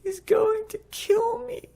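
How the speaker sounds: put-on tiny, very high, slow defeated child; mock-tearful whimper of a hopeless boy; imitated crying. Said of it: "starts crying".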